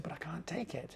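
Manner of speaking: hushed whispered plea; a nervous whispered protest. Retold or imitated. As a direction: whisper it so the chief won't hear. Pleading quietly with the translator.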